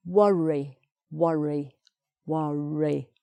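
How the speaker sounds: "worry" with a clearly sounded middle R; R on second syllable. Shown as a demonstration. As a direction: model "worry" carefully, R opening the second syllable. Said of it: "Second syllable".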